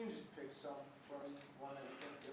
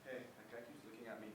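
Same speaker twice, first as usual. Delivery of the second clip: normal talking voice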